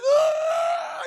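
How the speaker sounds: long, very high, loud scream; mock terrified scream. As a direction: imitate someone snatched into the sky screaming. Lifted into the air, screaming.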